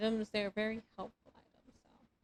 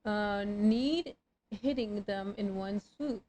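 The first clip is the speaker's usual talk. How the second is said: usual speaking voice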